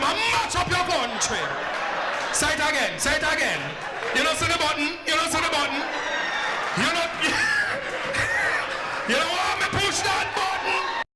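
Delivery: mock angry threat. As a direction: dare them to push him further. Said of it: "just mad".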